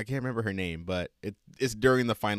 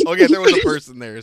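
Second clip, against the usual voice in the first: words squeezed out mid-laugh, much higher and louder; laughing hard; 13 dB louder, faster than usual. Real, not acted. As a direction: blurt it out through loud high laughter. Cracking up at the banana joke.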